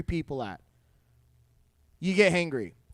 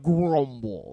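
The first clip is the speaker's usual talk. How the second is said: stomach grumble; 8 semitones lower than usual. Shown as a demonstration. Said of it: "the actual noise your stomach makes".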